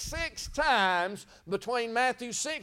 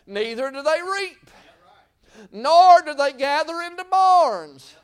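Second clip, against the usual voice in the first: slow and loud, climbing higher; preacher's crescendo; rising preaching; 4 semitones higher, 7 dB louder, slower than usual. Acted. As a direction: build to a shout at barns. Preaching climax.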